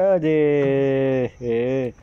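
ordinary talk, nothing marked

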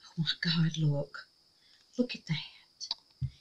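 slow hushed wonder; awed whisper. Real, not acted. Dimming the light to show viewers, whispering. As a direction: breathe it out, amazed.

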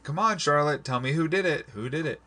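high, pleading paraphrase of a character; imitating Zachariah. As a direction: mimic him pressing for an answer, pitch up. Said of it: "And Zachariah's like".

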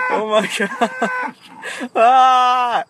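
loud startled shriek; startled yell. Real, not acted. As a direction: yelp as cows charge. Attacked by cows, yelling.

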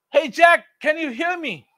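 a high, loud call from above. Acted. God calling down to the hanging Jack. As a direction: hail him from far above.

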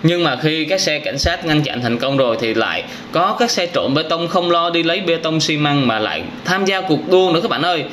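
ordinary talk, nothing marked

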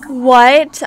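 loud, startled outburst; shocked disbelief. Real, not acted. Player finds she was too late, shocked. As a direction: blurt it out in disbelief. Sim died before the rescue.

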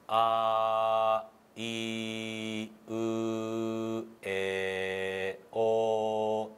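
「あ、いう、え、お」を一音ずつ区切って長めに; 母音を一つずつはっきり伸ばして; 母音の発音見本. Shown as a demonstration. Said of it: "ちょっとやってみたんですけど". (一音ずつ区切った母音の見本)

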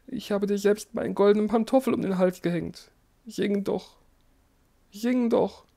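high, loud begging emperor; desperate pleading. A narrator's reading. Dying emperor begs the golden bird to sing.

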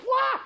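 sharp high shout of effort; high battle yell. Acted. Preacher voicing David's lunge with a yell.

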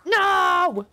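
jolted by a scare; startled no. Real, not acted.

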